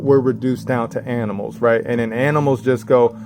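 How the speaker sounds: usual speaking voice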